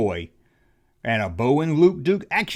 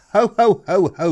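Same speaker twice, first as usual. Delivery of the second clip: lightly voiced Santa; 6 semitones higher, 6 dB louder than usual. Playing mall Santa.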